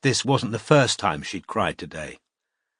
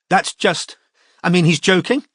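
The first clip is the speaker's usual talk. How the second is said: trying for incredulity; 7 semitones higher, 4 dB louder than usual. A narrator's reading. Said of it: "trying for incredulity".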